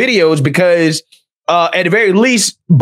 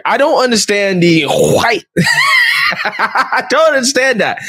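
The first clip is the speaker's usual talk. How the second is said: incredulous laugh breaking into a high shout; laughing disbelief; 5 semitones higher, slower than usual. Real, not acted. Reacting to a TikTok with laughing disbelief.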